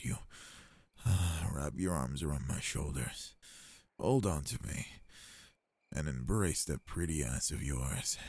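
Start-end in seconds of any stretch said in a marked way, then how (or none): none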